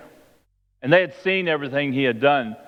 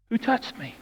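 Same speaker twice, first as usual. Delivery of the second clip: soft, higher-pitched asking; hushed question; 7 semitones higher, 6 dB quieter than usual. Acted. Jesus turns and looks through the crowd, hushed. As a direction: ask it quietly, pitched up.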